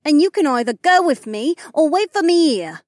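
loud, high-pitched angry demand; defiant ultimatum. A narrator's reading. Elf cuts off, gives ultimatum.

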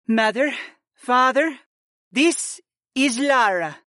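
high, slow princess voice; a daughter presenting her child; proud young mother. Acted. Freya introduces her daughter.

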